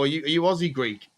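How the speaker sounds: ordinary delivery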